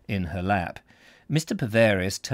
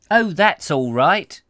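higher, louder, drawn-out reassurance; slow and raised, as a character brushing off an apology; reassuring Desiree; 8 semitones higher, 7 dB louder, slower than usual. Acted. Voicing Desiree in story.

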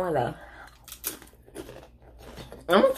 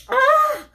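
high pained gasp from spice; 3 dB louder than usual. Real, not acted. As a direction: let out a high burning gasp. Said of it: "my eyes are like".

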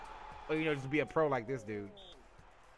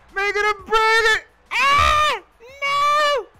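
excited yell; 12 semitones higher, 6 dB louder than usual. Real, not acted. Tense moment in game.

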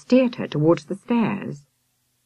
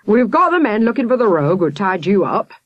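raised, urgent police shout; shouted report; 5 semitones higher, 4 dB louder than usual. A narrator's reading. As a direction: call it across the room to her. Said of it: "shouted at Miss Hilliard".

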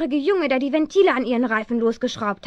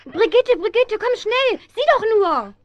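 calling out; 6 semitones higher than usual. Acted. Girl calls friend urgently.

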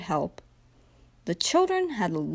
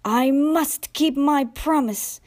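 slow, higher, effortful resolve; lightly acted panting; 5 semitones higher, slower than usual. A narrator's reading. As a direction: strain on like a tiring little engine. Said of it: "he panted".

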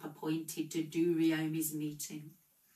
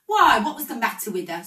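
higher and louder question; screeching; 5 semitones higher, 11 dB louder, faster than usual. A narrator's reading. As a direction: screech the question across the group. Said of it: "screeched a third".

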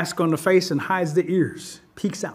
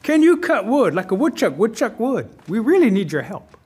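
lightly voiced animal asking for help, higher pitch; pleading animal character; 5 semitones higher, 4 dB louder than usual.

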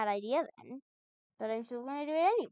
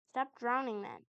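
ordinary delivery

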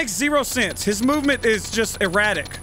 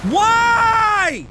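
loud high yell of despair; frustrated yell; 13 semitones higher, 6 dB louder than usual. Real, not acted. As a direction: scream it at the screen. Character turns around again.

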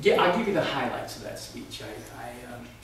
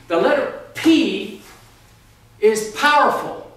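slow, loud, grand declaration; mock oration; 5 semitones higher, 6 dB louder, slower than usual. Comic speaker proclaiming to the audience, slow and grand. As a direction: proclaim it like a pompous orator.

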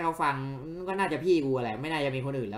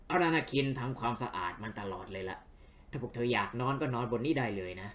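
a hint of a game character inviting friends along; understated, lower-key character read; lightly voiced friendly host; 5 semitones lower, 6 dB quieter than usual.

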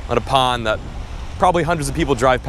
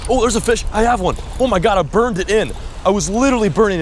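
real thrilled yelling; excited; 9 semitones higher than usual. Real, not acted. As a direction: blurt it out as the fish hits. Hooks a bass.

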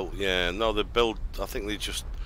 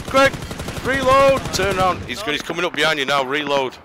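urgent shouting; 6 semitones higher, 5 dB louder than usual. Real, not acted. Urgently warning teammate in fight.

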